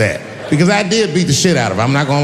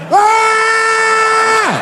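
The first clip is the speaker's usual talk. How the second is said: high scream; 4 dB louder than usual. Retold or imitated.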